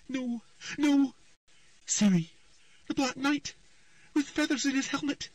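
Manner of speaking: high, rising panic; a child lost in a nightmare, pleading; frightened girl. A narrator's reading. Ciri's frightened trance speech.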